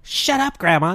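loud, high stand-up impression; mock shout from a comedy bit; John Mulaney. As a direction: yell it out like a comic's punchline. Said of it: "in the John Mulaney voice".

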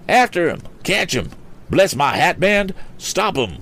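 high-pitched, urgent calls; shouting. A narrator's reading. Mister Damon calls friends after the fleeing thief, high shout. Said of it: "shouted Mister Damon".

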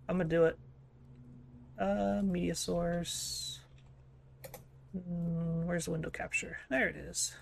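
quiet, slow mumbling while distracted; muttering to himself. Real, not acted. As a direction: mutter it under your breath. Muttering while setting up capture.

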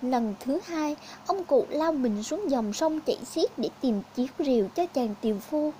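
ordinary delivery

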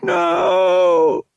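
loud, very high desperate scream. Acted. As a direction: scream as the blaster fires. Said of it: "Han screamed".

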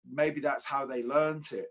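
usual speaking voice

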